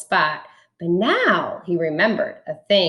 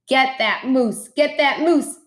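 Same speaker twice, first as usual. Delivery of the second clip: high calling out to the hunters; rally the hunters to shoot; 6 semitones higher than usual. A narrator's reading. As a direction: hunter shouting orders across the woods. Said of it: "heard a voice call".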